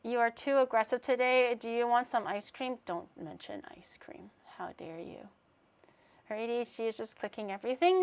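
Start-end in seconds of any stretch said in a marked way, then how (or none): 2.9-5.3 hushed, soft, pretend offended whisper; whispered mock outrage. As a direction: whisper it like a scandal.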